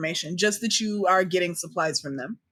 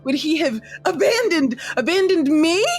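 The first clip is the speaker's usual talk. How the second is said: high, loud, frantic rant, crying out; unhinged rambling rant; 9 semitones higher, 4 dB louder than usual. Acted. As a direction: rave it out, furious and heartbroken. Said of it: "Homegirl, full tilt, is like".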